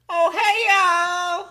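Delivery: high, drawn-out Southern greeting; Southern accent. Acted. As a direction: do an exaggerated Georgia accent. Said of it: "It's southern".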